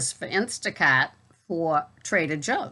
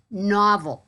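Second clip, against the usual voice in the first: higher, louder mock amazement; sarcastic; 5 semitones higher, 5 dB louder than usual. Real, not acted. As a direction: drily marvel at it. Sarcastic praise of phone support.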